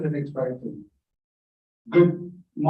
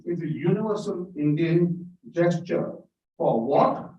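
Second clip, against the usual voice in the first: ordinary talk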